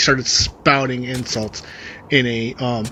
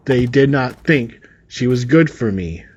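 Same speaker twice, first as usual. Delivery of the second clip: usual speaking voice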